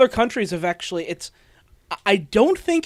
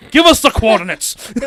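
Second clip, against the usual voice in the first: mock interrogator; 2 semitones higher, 7 dB louder than usual. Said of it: "walk into an interrogation room".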